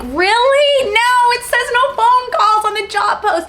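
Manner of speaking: very high, loud whiny objection; panicked protest. Retold or imitated. Daughter balking at calling, high loud protest.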